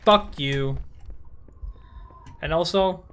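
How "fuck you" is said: loud, punchy repeated curse; angry cursing. Real, not acted. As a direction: spit it out each time, louder.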